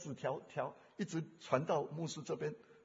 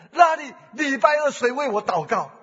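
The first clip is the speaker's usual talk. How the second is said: loud plea; 7 semitones higher, 17 dB louder than usual. Retold or imitated. Daughter's question a third time.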